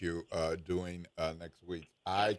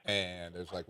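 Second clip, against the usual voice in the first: normal talking voice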